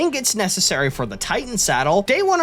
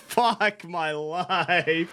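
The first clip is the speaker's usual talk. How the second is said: slow, drawn-out defeated groan; 4 dB quieter, much slower than usual. Real, not acted. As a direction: drag it out in despair. After losing creature.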